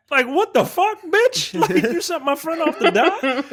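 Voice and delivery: high-pitched mock disbelief; incredulous outburst. Friend reacting to her confession, high-pitched comic disbelief. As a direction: squeal it in comic outrage.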